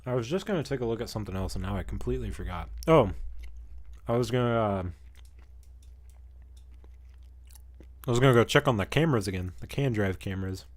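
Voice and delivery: talking while munching; words round a bite of food; chewing while talking. Real, not acted. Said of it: "Sorry if you can hear me munching".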